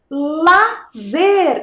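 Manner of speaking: "lazer" split into two drawn-out syllables, la, zer. Shown as a demonstration. Teacher asking children for the missing syllable, syllable by syllable. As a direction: sound out "lazer" with a gap before zer. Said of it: "que sílaba que está faltando".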